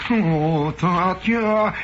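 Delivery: high, affected Westchester drawl; hammed-up Westchester-jaw socialite with a touch of Harvard; Westchester lockjaw, Harvard accent. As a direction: coo it in a mock Harvard accent. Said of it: "swinging Westchester jaw, too, and a little slight Harvard accent".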